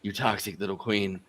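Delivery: low, soft imitation of an actor; Alec Baldwin. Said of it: "like, the Alec Baldwin voice".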